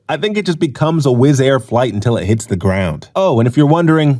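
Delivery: ordinary delivery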